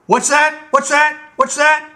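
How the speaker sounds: high, loud tyrant king yelling; furious royal shout. Acted. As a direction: drive the steward out in a rage. King enraged by "You're crazy.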